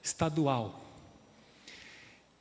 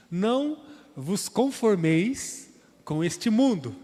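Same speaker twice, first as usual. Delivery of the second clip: solemn scripture quote; 4 semitones higher, 5 dB louder, slower than usual. Acted. Quotes classic Bible version.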